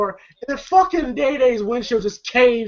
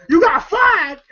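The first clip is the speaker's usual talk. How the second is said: high, loud disbelieving yell; shocked shout; 9 semitones higher, 4 dB louder than usual. Retold or imitated. Shocked question.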